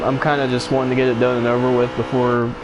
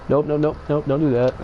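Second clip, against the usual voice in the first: ordinary talk, nothing marked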